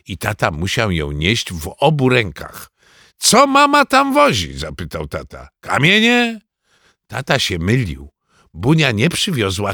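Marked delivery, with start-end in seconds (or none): 3.2-4.6 high, loud complaint; loud, incredulous question; exasperated father. Acted. Father protests at heavy suitcase. 5.6-6.4 high, rising exasperated question; exasperated high-pitched query; incredulous father. Acted. Father's sarcastic question about stones.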